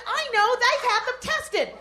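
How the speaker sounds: usual speaking voice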